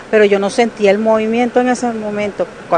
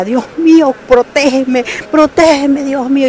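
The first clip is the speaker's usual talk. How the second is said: frightened prayer; 7 semitones higher than usual. Retold or imitated. Praying during the earthquake.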